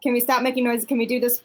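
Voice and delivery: ordinary delivery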